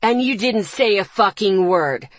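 loud, furious brother's voice; growling anger. Acted. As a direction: keep growling at her. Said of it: "Ethan growled".

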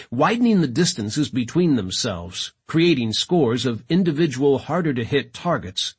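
ordinary delivery

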